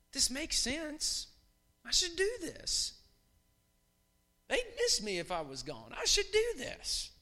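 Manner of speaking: high, slow mock inner voice; high slow mock reasoning; mock self-talk. Voicing self-talk at work.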